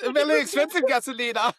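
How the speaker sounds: very high mock voice; playful mimicry. Co-host joining the gasolina joke, high playful mimicry. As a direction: echo the joke in a silly high voice.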